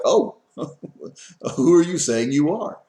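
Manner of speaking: loud, incredulous crowd jeer with a laugh; mocking disbelief. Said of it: "People standing around would be like".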